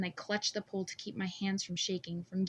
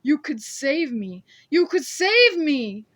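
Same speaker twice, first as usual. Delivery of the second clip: slow, much louder and higher begging cry; desperate pleading wail; 7 semitones higher, 14 dB louder, slower than usual. A narrator's reading. Scarred man begs her to save him, desperate. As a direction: beg her, drawn out and desperate.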